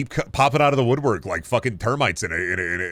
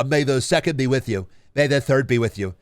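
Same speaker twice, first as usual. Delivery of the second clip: lightly voiced annoying coworker, droning the greeting; mock coworker greeting. Mocking the annoying coworker's daily greeting, lightly put-on voice. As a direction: parody the coworker who says it every day.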